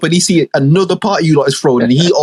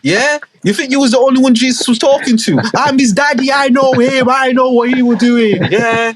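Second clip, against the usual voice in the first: tipsy ranting God; 8 semitones higher than usual. Acted. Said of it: "he in there tipsy like".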